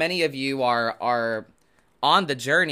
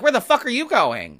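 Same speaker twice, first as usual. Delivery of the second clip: jealous friends; 8 semitones higher than usual. Retold or imitated. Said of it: "And they were like".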